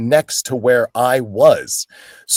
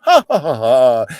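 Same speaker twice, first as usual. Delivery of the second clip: mock crowd laugh; 4 dB louder than usual. Warming up the crowd, a mock laugh. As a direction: act out the fake audience laughter.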